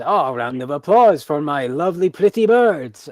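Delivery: loud, high, rising ringmaster call; showman calling applause. Acted. Tamer asking the crowd to applaud his birds, loud showman call. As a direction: whip up the applause.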